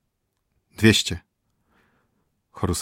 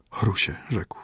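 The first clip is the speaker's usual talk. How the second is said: hushed whisper; 5 dB quieter than usual. A narrator's reading. Physician speaks to dying prince.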